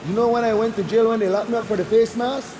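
usual speaking voice

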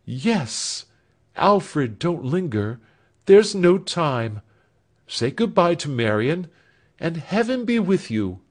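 a touch of an eager elder sister; urging elder sister. Acted. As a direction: send him off kindly but quickly. Elder sister urges Alfred off.

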